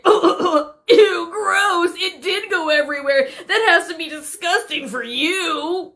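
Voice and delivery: high-pitched, mock-disgusted prankster; gleeful prankster. Prankster taunting the worker after making a mess, mock-disgusted. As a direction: put on a high, giggly disgust.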